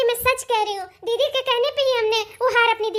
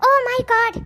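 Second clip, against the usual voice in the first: usual speaking voice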